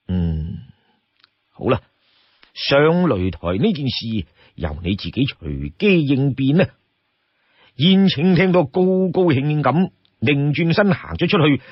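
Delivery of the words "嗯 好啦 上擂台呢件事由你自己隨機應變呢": low, considered assent; lightly voiced chief granting permission; Song Jiang. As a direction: concede thoughtfully in a lowered voice.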